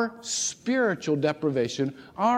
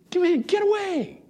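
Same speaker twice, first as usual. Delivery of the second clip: urging; 14 semitones higher than usual. Acted. Urging listeners to retreat.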